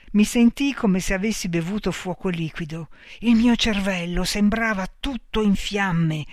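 usual speaking voice

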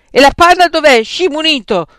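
high, very loud angry demand; furious roar. A narrator's reading. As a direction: roar like a wounded lioness. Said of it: "ruggì Frank come una leonessa ferita".

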